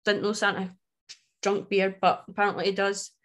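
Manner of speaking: ordinary talk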